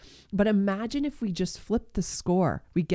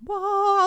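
high, loud mock revelation cry; hammed-up revelation exclamation; mock fanfare; 13 semitones higher, 4 dB louder than usual. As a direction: burst out like a big epiphany. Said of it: "this big, like".

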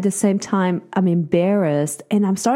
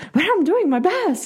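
defensive husband; 10 semitones higher than usual. Retold or imitated. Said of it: "And you're like".

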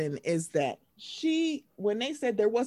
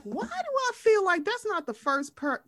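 mock disbelief; 10 semitones higher than usual. Retold incredulous thought.

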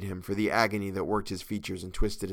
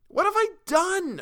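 very high, slow, louder desperate wail; desperate cry; 6 dB louder than usual. A narrator's reading. Captive cries again to the mob, desperate high wail. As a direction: wail it out in despair. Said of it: "I cried again".

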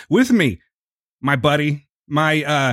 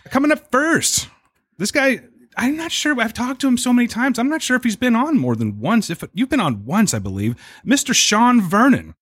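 announcer; 9 semitones higher than usual. Acted. Host introducing guest.

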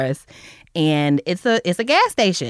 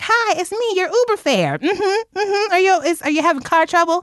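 much higher, sugary mock-polite greeting; mock customer-service sweetness, pitched high; fake sweet voice; 12 semitones higher than usual. Mock sweet greeting to stalled driver.

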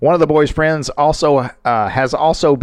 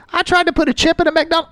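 high mocking mother voice; 13 semitones higher than usual. Quoting the mother.

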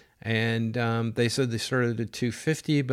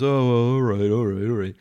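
a hint of a grudging buyer, louder; louder, reluctant giving in; grudging termite guy; 7 dB louder than usual. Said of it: "he goes".

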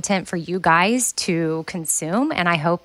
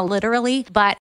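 normal talking voice

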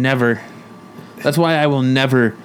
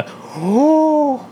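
high drawn-out mock gasp; big theatrical intake of breath; shocked gasp; 12 semitones higher than usual. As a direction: gasp in exaggerated horror. Acting out shock at cashier's question.

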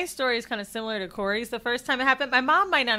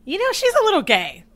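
knowing mom; 10 semitones higher, 4 dB louder than usual. Retold or imitated. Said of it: "she would always tell me".

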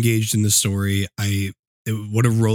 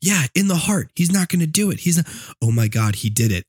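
excited; 7 semitones higher than usual. Retold or imitated. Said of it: "being like".

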